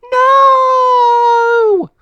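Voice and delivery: very high, loud anguished scream; cry of despair. A narrator's reading. Casey cries out as Monty is caught. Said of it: "cried Casey".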